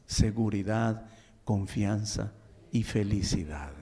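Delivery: tender hushed sermon voice; soft intimate preaching. Acted. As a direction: draw the listeners in gently, almost confiding.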